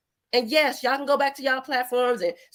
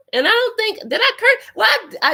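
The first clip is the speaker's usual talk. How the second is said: higher and louder, incredulous; indignant disbelief; 10 semitones higher, 6 dB louder than usual. Real, not acted. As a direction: protest in disbelief. Indignant self-questioning about cursing.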